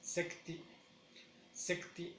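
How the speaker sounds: "secti" with the emphasis on a cut-short ti; sec, then a crisp ti; stress on second syllable. Shown as a demonstration. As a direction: land on the ti of "secti". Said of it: "ponemos más énfasis en la segunda sílaba".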